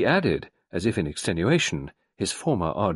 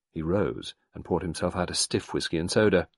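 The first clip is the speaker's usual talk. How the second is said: normal talking voice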